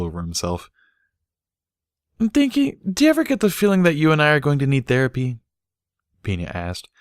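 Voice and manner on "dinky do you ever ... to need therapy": put-on high, fast childish voice; little filly. A narrator's reading. Little filly asking her friend about therapy. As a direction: rattle it off like a worried kid.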